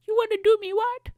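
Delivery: very high, loud squeaky voice; cartoonishly high-pitched bafflement; mock shocked listener. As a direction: squeak it back in silly disbelief. Acts the confused listener replying.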